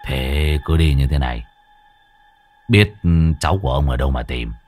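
low doubting grumble. A narrator's reading. Muôn Phọt asks Mo Tùng where to search, low doubting voice.

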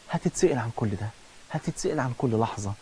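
soft, low, grave warning; hushed warning. Real, not acted. Preacher quietly warns the listener he will be asked. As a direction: drop low and warn them gravely.

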